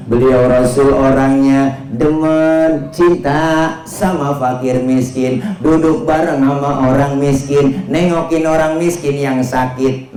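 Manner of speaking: slow, sustained sermon cadence; intoned preaching. Real, not acted. Preacher telling of the Prophet's love for the poor, intoned. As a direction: stretch the key words almost in chant. Chanting translation in preaching style.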